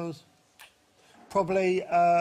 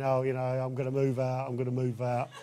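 normal talking voice

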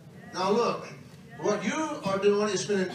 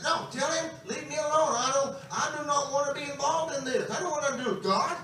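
higher-pitched stubborn protest; mock exasperated refusal, pitched up; defiant attitude; 5 semitones higher than usual. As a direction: imitate a son digging his heels in. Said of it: "But I had an attitude".

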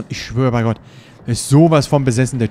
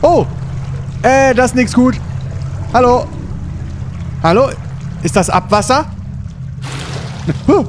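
panicked yelling; 11 semitones higher than usual. Real, not acted. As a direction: call out in rising panic. Jump scare in game.

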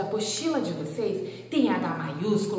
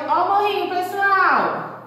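higher and louder, delighted; excited; 9 semitones higher, 6 dB louder than usual. Shown as a demonstration. Teacher shows kids the little hump, excited. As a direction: make the hump sound like fun. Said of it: "shows the hump stroke".

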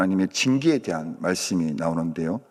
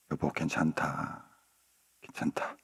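low, very soft, almost whispered; tender reassurance; 6 semitones lower, 11 dB quieter than usual. Acted. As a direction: soothe her as if holding her close. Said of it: "꼭 안아주면서". (안아주며 나지막이 다독이는 소리)

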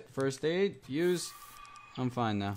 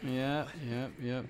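ordinary talk, nothing marked

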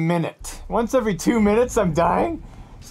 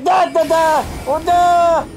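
high, loud staccato "da" sounds; mock the boss with loud nonsense syllables; mimicked attack noises; 9 semitones higher, 5 dB louder, slower than usual. Mimics the boss mid-rant.

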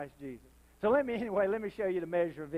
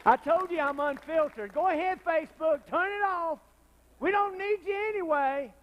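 high, loud taunt at Facebook; defiant preaching; 10 semitones higher, 4 dB louder than usual. Real, not acted. Preacher defying Facebook censors, high and loud. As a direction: shout it out, daring them to cut you off.

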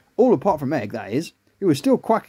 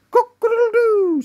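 very high, loud crowing; rooster crow; 5 dB louder than usual. Acted. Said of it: "making animal noises".

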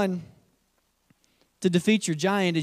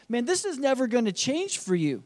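ordinary delivery

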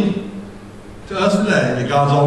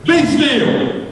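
high, ringing command to the storm; rebuking the wind; commanding shout; 9 semitones higher, much slower than usual. Acted. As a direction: call out with full authority.

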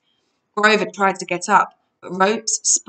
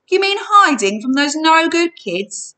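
grumbling, higher-pitched salesman; 9 semitones higher, 4 dB louder than usual. A narrator's reading. Salesman to intruders about no-good kids, grumbling. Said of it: "he grumbled".

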